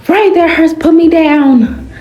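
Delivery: pained and pleading, pinned to the wall. Acted. Pride pinned against the wall, begging Ray in pain. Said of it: "I said on pain".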